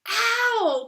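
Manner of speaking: sharp high yelp of pain; pained cry. A narrator's reading. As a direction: cry out as he's pulled. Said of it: "cried Pooh".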